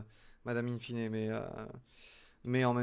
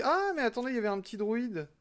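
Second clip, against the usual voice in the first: much higher sudden realisation; surprised; 10 semitones higher than usual. Real, not acted. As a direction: jump up in pitch as you notice it. Spots a little droid.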